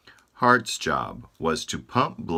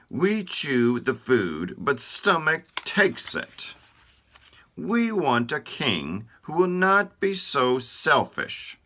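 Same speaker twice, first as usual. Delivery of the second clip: Teeth grumbling; 8 semitones higher than usual. Acted. Teeth protesting that Stomach takes their food, high and grumbling. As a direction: gripe like a wronged little helper.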